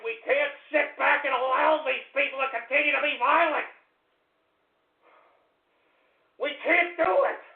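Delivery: rising, high-pitched outrage; worked-up ranting cry; angry. Real, not acted. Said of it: "I'm so goddamn angry".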